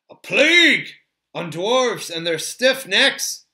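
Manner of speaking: loud, high, drawn-out curse; vexed elf, slow and forceful; exasperated Legolas. Acted. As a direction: burst out in impatience at a stubborn companion. Legolas curses the stubborn dwarf.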